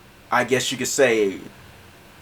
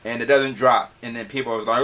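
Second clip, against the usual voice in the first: ordinary talk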